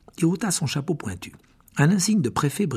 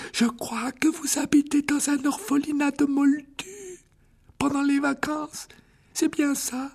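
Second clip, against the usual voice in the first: put-on high, slow, curious old wizard; interested old headmaster; 14 semitones higher than usual. A narrator's reading. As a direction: ask with gentle interest as the frail headmaster. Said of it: "d'un air intéressé". (vieux directeur curieux, voix aiguë)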